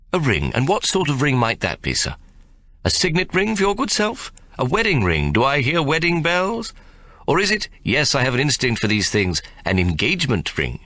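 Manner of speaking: bow and scrape politely; lightly voiced fawning shopman. A narrator's reading.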